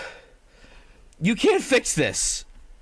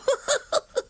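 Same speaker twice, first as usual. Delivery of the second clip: high squeaky laugh. Real, not acted. Laughs after asking for money.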